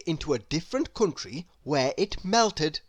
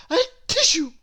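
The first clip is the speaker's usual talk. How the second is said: high sneeze spoken as a tissue; comic sneeze; 12 semitones higher, 6 dB louder, faster than usual. A narrator's reading. Mister Sneeze sneezes on the path, high comic sneeze.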